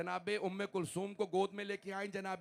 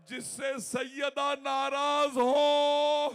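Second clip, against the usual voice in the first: much higher, louder, slow anguished cry; slow high anguished wail; wailing shout; 11 semitones higher, 14 dB louder, much slower than usual. Real, not acted. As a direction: burst out crying the line. Sudden cry in mourning sermon.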